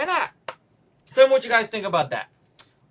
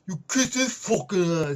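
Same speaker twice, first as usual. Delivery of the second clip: garbled action-hero impression; Dolph Lundgren; 3 semitones lower than usual. Action hero answering his rival's threat, mock fighter voice. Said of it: "And, and Dolph Lundgren".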